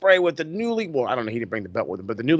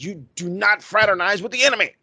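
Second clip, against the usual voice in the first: stern mock scold; 6 semitones higher than usual. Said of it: "M J F is like".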